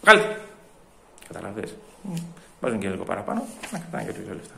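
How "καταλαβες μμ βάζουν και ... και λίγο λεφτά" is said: low, very soft aside; muttered suspicion. Acted. Customer grumbling aside about extra weight and money, muttering. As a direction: mutter distrustfully under your breath.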